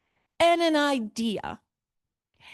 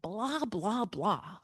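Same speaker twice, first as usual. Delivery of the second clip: soft, dismissive blah blah blah; mocking boredom; 5 semitones lower, 6 dB quieter, much slower than usual. Teacher mocking how students hear the prose, dismissive. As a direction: brush the prose off as droning. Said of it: "Why do students immediately not like this?".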